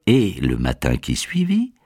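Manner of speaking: usual speaking voice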